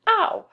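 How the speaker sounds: high, loud yelp of mock pain; hurt "ow". Shown as a demonstration. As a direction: yelp OW as if you just hurt yourself. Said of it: "like you hurt yourself".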